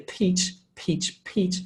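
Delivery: "Peach" softly with a puff of breath; quiet aspirated "Peach, peach"; breathy unvoiced "Peach" start. Shown as a demonstration. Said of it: "coming more from the front of your mouth".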